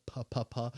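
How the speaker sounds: soft repeated "Puh, puh, puh" plosives; P sound drilled. Shown as a demonstration. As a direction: pop each "Puh" softly. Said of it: "You gotta go".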